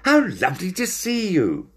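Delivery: high-pitched delighted greeting; thrilled to see friends; excited. A narrator's reading. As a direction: gush in a high voice. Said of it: "he said excitedly".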